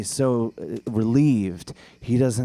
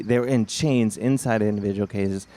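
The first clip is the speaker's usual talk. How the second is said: normal talking voice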